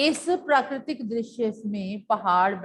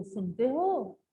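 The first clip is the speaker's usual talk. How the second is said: a light call across the house; lightly voiced caller asking are you listening; soft mock call, are you listening; 5 dB quieter, slower than usual. Shown as a demonstration. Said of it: "जैसे किसी ने आवाज दी हो".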